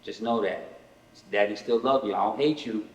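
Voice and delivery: soft, low reassurance to his son; reassuring dad. Retold or imitated. Father on the phone calming his ten-year-old, soft and reassuring. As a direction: gently tell him he's still loved. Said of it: "I said".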